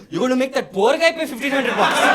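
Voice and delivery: high, loud theatrical disbelief; scandalized on someone else's behalf; mock shock. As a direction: plead in hammed-up outrage. Acted shock at the fine.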